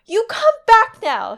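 high, loud, drawn-out call; Shireen yelling. Acted. Girl chasing her playmate through the garden, yelling. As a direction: yell it after a runaway playmate. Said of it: "Shireen yells at him".

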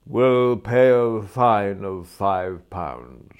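loud, slow pronouncement; stern magistrate. Acted. Magistrate fining the prisoner in court, loud and ponderous. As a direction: hand down the fine slowly and loudly.

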